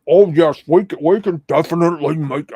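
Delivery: loud, overblown voice imitation; Ewar impression. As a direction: push out a loud, clumsy mimicry of a creepy voice. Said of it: "I can't even do the Ewar".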